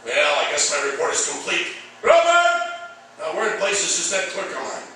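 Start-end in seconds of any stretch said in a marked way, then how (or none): 2.0-2.9 high, loud call across the room. Acted. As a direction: summon the clerk loudly. Chief calls clerk from office.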